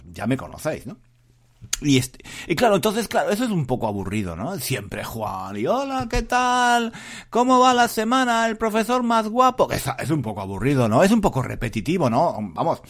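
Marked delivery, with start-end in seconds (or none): 5.7-9.7 mock greeting. Mocking listeners' view of him.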